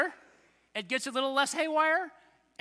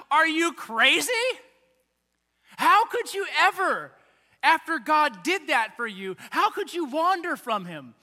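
loud, high, incredulous demand; incredulous outburst; 5 semitones higher, 7 dB louder than usual. Pretend. Congregation yelling at faithless Israelites, loud and incredulous. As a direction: shout your disbelief at them. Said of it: "any of us are going".

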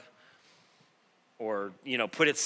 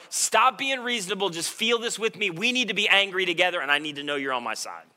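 higher, louder imitation of an upset wife; mock frustrated wife; 6 semitones higher, 4 dB louder than usual. Wife demanding husband share her anger, mock exasperated. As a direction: plead with him to get angry too. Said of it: "the equivalent of my wife going".

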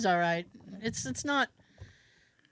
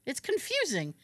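high, fast exasperated retelling; mock-baffled rant, pitched up; incredulous complaint; 7 semitones higher, faster than usual. Host retelling his reaction to a recast daughter, incredulous.

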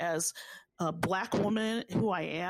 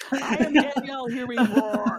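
higher, louder, laughing declaration of her name; laughing proclamation; 6 semitones higher, 9 dB louder than usual. Real, not acted. Cohost answering his joyful proclamation, laughing and louder. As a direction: join in with laughing pride.

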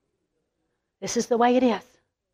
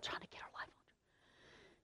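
hushed whisper; 32 dB quieter than usual. Real, not acted. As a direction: drop to a whisper for effect. Whispered aside about old woman.

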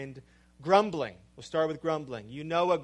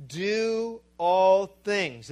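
preaching; 3 semitones higher, 5 dB louder, much slower than usual. Acted. Preaching the text.

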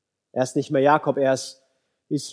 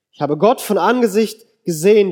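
raised, higher, louder exclamation; crying out; 5 semitones higher, 5 dB louder than usual. A narrator's reading. Preacher reads Jacob's cry after meeting God, crying out. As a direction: call it out in amazement. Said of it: "rief Jakob".

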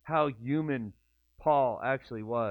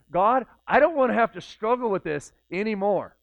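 higher and louder plea to God; higher, louder desperate prayer; pleading; 7 semitones higher, 7 dB louder than usual. Retold or imitated. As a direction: beg God to take it away.

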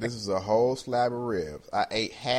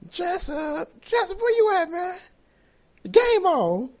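high, loud call across the house; hammed-up high calling voice; mock calling out; 8 dB louder than usual. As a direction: shout for Jessup from another room. Calling employee to watch game.

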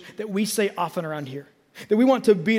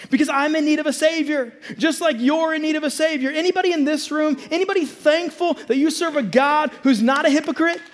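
loud, high preaching building to a shout; preaching at full force; 6 semitones higher, 3 dB louder than usual. Acted. Pastor rallying the congregation, rising full-force preaching. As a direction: lift the room, preaching hard.

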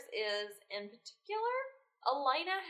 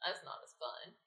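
deflated; 5 semitones lower, 7 dB quieter than usual. Retold or imitated. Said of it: "and I was like".

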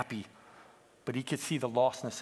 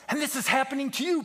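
high, quick, louder warning; urgent confrontation; 12 semitones higher, 5 dB louder, faster than usual. Acted. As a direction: drive it home to the sheep. Peep confronts the sheep.

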